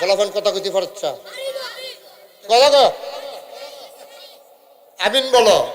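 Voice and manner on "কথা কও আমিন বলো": calling out. Real, not acted. Urging silent crowd to respond.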